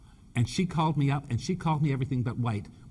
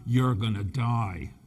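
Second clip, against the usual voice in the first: retold horrible scream; much slower than usual. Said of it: "horrible scream that could only be".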